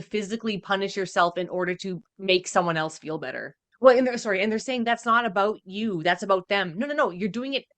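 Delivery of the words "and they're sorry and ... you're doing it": ordinary delivery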